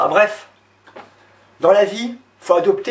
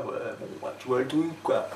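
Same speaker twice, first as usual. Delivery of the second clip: laid-back swagger, half-whispered; cocky guy voice; 6 semitones lower, 12 dB quieter than usual. Shown as a demonstration. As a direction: play the guy who's sure of himself. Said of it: "Un mec qui parle comme ça".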